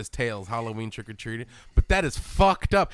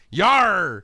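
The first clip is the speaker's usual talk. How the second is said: mock pirate cry; 3 semitones higher, 7 dB louder than usual. Pirate joke.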